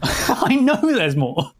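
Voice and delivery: slow, stretched out and high; slow drawn-out tease. Acted. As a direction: drag every word out slowly.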